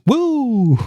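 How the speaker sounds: long, drawn-out high woo; high, slow playful cheer; woo-girl whoop. Acted. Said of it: "She's a woo girl".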